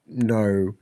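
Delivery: ordinary talk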